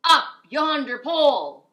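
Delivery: loud, slow, high crew shout; pirate crew calling farewell. Acted. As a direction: belt it like a sea chant. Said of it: "the others shouted".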